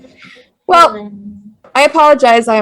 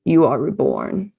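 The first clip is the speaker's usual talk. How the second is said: low, soft, much lower menace; same cold vampire; 5 semitones lower, 9 dB quieter than usual. Acted. Vampire sire greeting his new childe, quiet menace. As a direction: savor the moment, quietly. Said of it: "That same voice".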